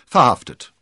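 loud, curt dry answer; high, dry one-word verdict. A narrator's reading. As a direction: state it drily in one burst. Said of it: "sagte Athos trocken".

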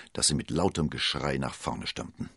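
ordinary delivery

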